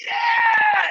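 very high, long-held yell; contestant's answering cheer. Retold or imitated. Contestant yelling back to the crowd, long high whoop. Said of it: "And I went".

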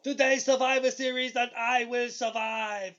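a hint of a big wrestler's promo voice; lightly voiced giant cutting a promo; Andre impression. Cohost imitating Andre's cheesy promo, lightly put-on voice. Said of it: "He goes".

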